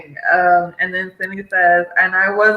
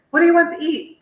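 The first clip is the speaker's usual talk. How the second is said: much higher-pitched quoted question; high, hammed-up asking for a decision; acted dinner question; 10 semitones higher, much faster than usual. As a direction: ask it in a high put-on voice. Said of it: "guy's thing when they ask the woman".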